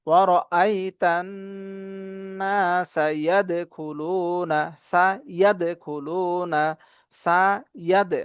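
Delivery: slow "ইয়াদখুলুনা", syllable by syllable; measured teaching chant, "ইয়াদখুলুনা" in pieces; broken into pieces. Shown as a demonstration. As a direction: separate and stretch each part of "ইয়াদখুলুনা". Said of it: "ভেঙে ভেঙে করুন".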